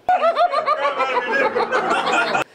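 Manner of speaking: high, breathless laugh; real laughter. Real, not acted. Recalling the startled clown, real high laughter. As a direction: let the laugh spill out high.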